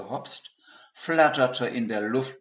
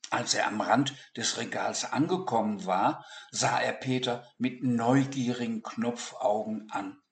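ordinary delivery

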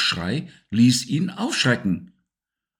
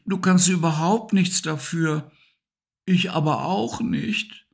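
high, patient creature voice; gentle Siggi; 8 semitones higher than usual. Acted. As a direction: calmly explain to your friend why you must go. Siggi gently explains the parting. (hohe, geduldige Stimme von Siggi)

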